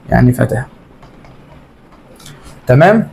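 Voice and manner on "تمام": ordinary talk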